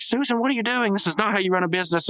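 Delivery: high, loud, fast scolding; hammed-up angry boss yelling; shouting at the giant Susan, hammed-up outrage; mock outraged yell. Mailer yells at Susan.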